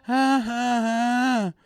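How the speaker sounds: very high, loud, slow mock kid chant; mock child yelling ha ha ha; imitating kid noise. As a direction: bleat it like an annoying child. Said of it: "Kid was just sitting there going".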